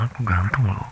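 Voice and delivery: whispered playful boast; a secretive, teasing whisper. Acted. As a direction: breathe the brag close to the ear.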